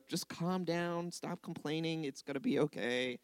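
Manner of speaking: put-on soft, hushed parent soothing a whiny child; hushed reassuring parent; parent calming child. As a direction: quietly settle a whining kid down. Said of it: "Doesn't he sound like a parent".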